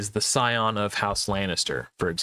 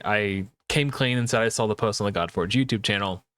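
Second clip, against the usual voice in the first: ordinary delivery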